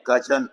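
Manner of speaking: कजन said with a short schwa, not कजिन with ee; correct pronunciation. Shown as a demonstration. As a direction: say कजन with a light uh in the second syllable. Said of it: "ई की आवाज नहीं आएगी".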